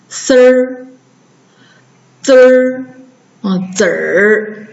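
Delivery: 丝儿, 滋儿, 子儿 with i dropped for "er"; erhua demo. Shown as a demonstration. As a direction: model 丝儿, 滋儿, 子儿 as single r-coloured syllables. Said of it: "一都不读了".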